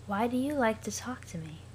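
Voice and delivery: lifeless, monotone Aradia; flat as if long dead. Acted. Aradia asks Sollux about teams, in a dead monotone. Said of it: "that's how I'll be reading Aradia".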